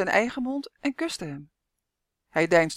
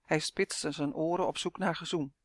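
plain reading voice

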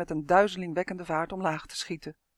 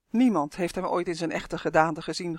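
plain reading voice

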